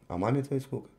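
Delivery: ordinary talk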